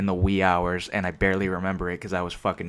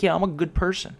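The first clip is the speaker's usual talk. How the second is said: high, mocking imitation of a do-gooder; mock someone pleased with their own goodness; fake self-congratulation; 9 semitones higher than usual. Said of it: "It's all fucking fake".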